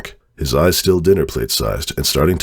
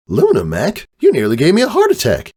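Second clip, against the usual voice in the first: startled Caramel; 11 semitones higher than usual. Acted. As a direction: blurt it out after a near crash, higher.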